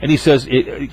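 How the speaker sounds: normal talking voice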